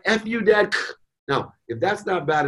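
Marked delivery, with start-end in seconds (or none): 0.0-0.7 high-pitched mock retort; angry, defiant. Daughter cursing at her dad, angry. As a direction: throw it out high, acting a furious daughter. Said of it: "she would have answered in anger".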